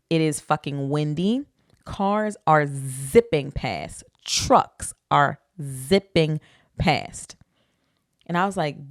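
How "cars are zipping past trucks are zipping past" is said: drawn out, word by word; slow dramatic emphasis. Real, not acted. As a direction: stretch each word for dramatic effect. Dramatizing traffic beside the highway.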